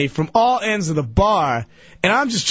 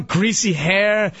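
ordinary talk, nothing marked

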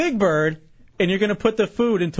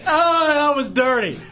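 high, breathless laughter through words; cracking up in disgust; laughing hard; 8 semitones higher, much slower than usual. Real, not acted. Host reacts to the bird lick.

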